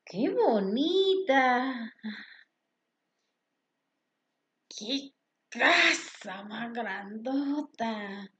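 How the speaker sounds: slow, drawn-out exclamations; exclamation example. Shown as a demonstration. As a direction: stretch each exclamation for the class. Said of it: "Por ejemplo".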